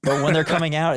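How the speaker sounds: ordinary delivery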